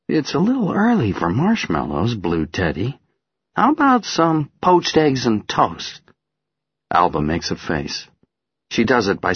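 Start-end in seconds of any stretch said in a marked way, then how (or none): 0.1-6.0 playful. Acted. Father playfully addresses teddy.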